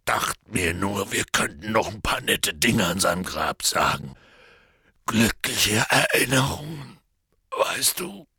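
shaky voice cracking at the end; tremble, then let it break; voice trembling and breaking. Acted. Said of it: "Seine Stimme bebte und brach".

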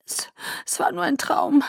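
high, tearful stammer; holding back sobs; quiet sobbing. A narrator's reading. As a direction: choke it out through tears. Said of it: "das leise Schluchzen nicht zurückhalten".

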